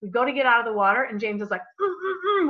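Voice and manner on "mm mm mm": urgent mm mm through a snorkel mask; muffled mask hums. Retold or imitated. Husband signals a turtle with muffled mask hums. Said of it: "with his mask on".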